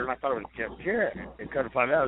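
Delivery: usual speaking voice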